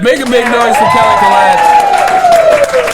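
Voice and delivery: very high, loud, slow hype call; announcer shout. Acted. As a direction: rev up the crowd like an MC. Host hypes crowd for winner.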